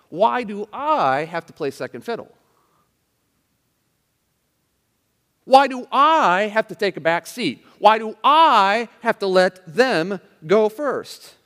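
loud, high protest leaning on "I"; resentful attitude. Acted. Preacher voicing believers refusing second place, loud resentful complaint. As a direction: protest indignantly, punching every "I". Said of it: "you hear the attitude".

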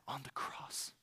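whisper. Real, not acted. Climax of sermon point.

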